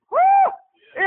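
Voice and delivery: high joyful whoop from the pulpit; preacher's whoop; excited whoop. Real, not acted. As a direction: let out a loud happy woo.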